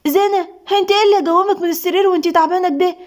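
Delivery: high, fast startled question; alarmed. A narrator's reading. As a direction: react in panic, rushing the words. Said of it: "سلمى بفزع".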